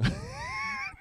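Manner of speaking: high squeaky disbelieving laugh; incredulous laugh. Real, not acted. Father laughing at son's excuse, high squeak.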